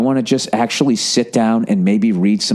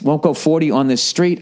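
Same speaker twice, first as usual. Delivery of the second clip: ordinary talk, nothing marked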